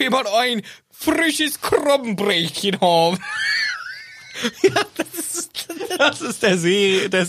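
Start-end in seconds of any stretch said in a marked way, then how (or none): 3.2-3.9 high shrieking laugh. Real, not acted. Laughing after fish gag.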